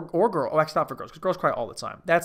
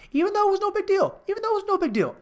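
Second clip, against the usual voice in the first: squeaky, far higher mock-tearful voice; put-on squeal of crying; high-pitched mock crying; 3 dB louder, faster than usual. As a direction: push it way up into a fake weepy squeak. Mock weeping about holding it in.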